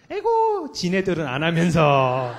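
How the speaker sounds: mock a child grumbling about hypocrite parents; scoffing child's thought. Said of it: "다 속으로 이렇게 생각하잖아". (비꼬듯 높은 에고)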